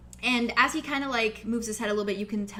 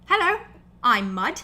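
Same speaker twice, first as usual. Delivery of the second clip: Mud the orc greeting; 3 semitones higher, 9 dB louder than usual. Acted. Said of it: "he reaches his hand out to you and he says".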